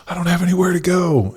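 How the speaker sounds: a stuck, helpless cry of no options; high desperate inner voice. Retold or imitated. To himself when the scale stalls, high panicked voice.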